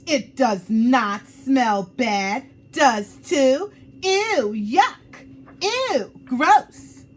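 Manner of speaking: put-on loud, high, escalating kid quarrel; angry shouting. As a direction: yell it like a playground fight. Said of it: "Lily scowled".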